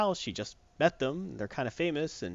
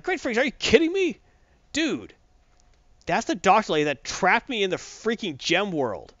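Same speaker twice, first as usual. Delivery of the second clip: higher-pitched, exasperated mock outburst; freaking out; 7 semitones higher, 3 dB louder than usual. Connor learns his captor was there, hammed-up freak-out. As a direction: act out an indignant freak-out, starting very high. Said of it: "To which Connor freaks out".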